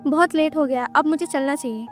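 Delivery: ordinary delivery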